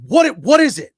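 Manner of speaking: high, loud exasperated retort; mock aggravated yell; imitated aggravation. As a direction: mimic a fed-up coworker snapping back. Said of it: "he was so aggravated".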